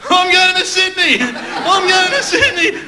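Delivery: high, slow, overjoyed shouting of the news; excited. Retold or imitated. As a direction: yell it like a thrilled kid, stretching the words. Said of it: "And you go".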